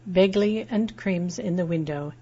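plain reading voice

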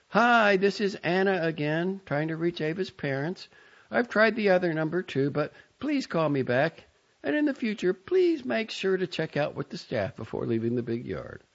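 high, polite coordinator voice; bright professional voicemail. A narrator's reading. Second, firmer voicemail.

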